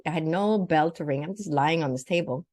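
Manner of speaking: ordinary talk, nothing marked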